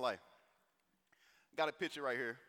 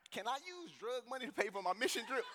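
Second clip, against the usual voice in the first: high-pitched perplexed question; acted bewilderment, pitched up; baffled; 8 semitones higher than usual. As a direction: ask it in comic disbelief, voice raised. Baffled question to mentor, for laughs.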